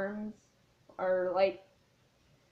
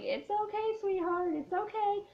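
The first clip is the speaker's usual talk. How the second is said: high, soothing old-aunt voice, imitated; calm great aunt; 11 semitones higher than usual. Great aunt soothes a crying child, high calm imitation. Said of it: "she's just all calm about it".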